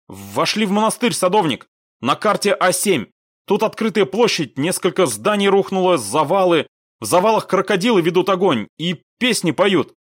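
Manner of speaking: high, forceful field report; urgent radio report. A narrator's reading. Squad leader reporting from ruined monastery, urgently. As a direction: call the situation in under fire.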